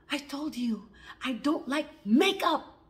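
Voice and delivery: slow, loud, emphatic scolding; deliberate, forceful retold anger; mad mother. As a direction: re-enact an angry mother laying down the law. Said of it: "she was really mad".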